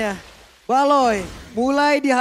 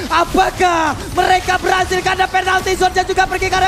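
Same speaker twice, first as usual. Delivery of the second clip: fighting game announcer; 3 semitones higher than usual. Real, not acted. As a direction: stretch the words like an arena announcer. Caster calling the game-winning push.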